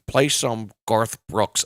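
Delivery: put-on loud, drawn-out drunken request; slow, loud heckle from a drunk clubber; drunk guy. Drunk guy demanding a song from the DJ, loud drunk slur. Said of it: "get a drunk guy come up".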